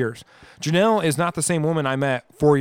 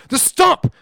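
high, loud imitation of an irate passenger; retold yelled answer; 6 dB louder than usual. Imitating passenger answering the driver, high yell. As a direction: throw the answer back at the driver.

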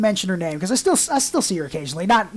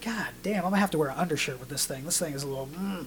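much softer, grumbled aside; muttering to himself; 4 semitones lower, 11 dB quieter, faster than usual. Retold or imitated. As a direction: grumble it low-key under your breath.

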